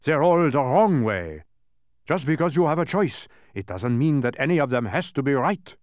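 high, loud, emphatic correction; indignant dodecahedron telling-off; upset scolding. A narrator's reading. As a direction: set them straight, upset. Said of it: "glaring from his most upset face".

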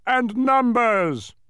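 loud, high, drawn-out call; forceful addition. A narrator's reading. Mathematician adds his rival cause, forceful. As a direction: punch it out slowly and loudly. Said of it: "added the mathematician forcefully".

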